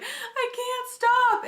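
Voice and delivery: high, drawn-out mock-crying wail; tearful wail. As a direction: wail it through helpless sobs, hammed up. Retold crying fit at movie.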